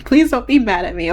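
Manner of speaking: ordinary talk, nothing marked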